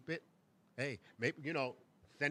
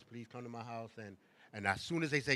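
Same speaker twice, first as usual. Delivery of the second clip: ordinary delivery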